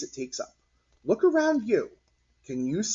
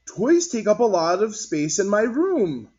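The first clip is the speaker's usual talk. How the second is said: put-on higher, louder kid's voice; child voice; 7 semitones higher, 5 dB louder than usual. Martin telling friends about toys, high child voice. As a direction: speak up like a young boy.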